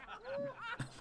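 put-on squeaky high drunken giggle; a tiny tipsy squeal of laughter; Fortunato's high drunken giggle.

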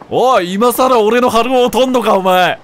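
loud, slow, furious challenge; a loud, slow, furious challenge to the man who took his partner; angry confrontation. Acted. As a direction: shout him down, drawing each word out.

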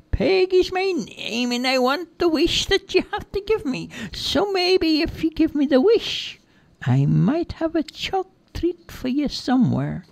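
very high-pitched character voice; pushy captor, way up high; Peggy, insistent bargaining. Acted. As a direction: bargain in a squeaky-high sing-song. Peggy bargains for her wish.

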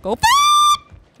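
sudden high shriek of fright; startled scream. Real, not acted. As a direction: yelp in real panic. Jumpscare in horror game.

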